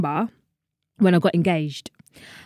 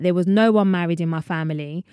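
ordinary delivery

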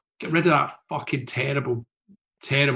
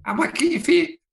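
tiny squeaky voice, much higher; mad wee voice; 3 dB louder than usual. Retold or imitated. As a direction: put on a silly little high voice for the guy at the desk. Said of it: "some mad wee voice".

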